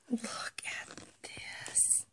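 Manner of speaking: whisper. Real, not acted. Hushed aside over dog toy.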